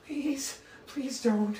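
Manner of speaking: high, frightened begging; fearful pleading. Acted. Frightened soldier begs the intimidating attacker, pleading. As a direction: beg to be spared. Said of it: "gets this look of fear".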